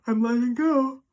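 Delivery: letting go. Real, not acted. Spontaneous emotional release mid-reading.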